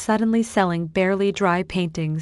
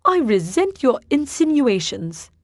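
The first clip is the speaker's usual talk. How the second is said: higher, haughty indignation; 6 semitones higher than usual. A narrator's reading. Theodore haughtily rejecting Bertie's accusation. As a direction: say it with your nose in the air. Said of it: "nose pointing to the ceiling".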